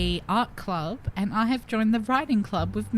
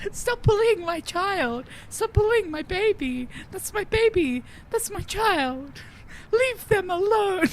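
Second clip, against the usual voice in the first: high mock outrage; mock protective wail; 11 semitones higher than usual. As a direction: wail like a hammed-up protective parent.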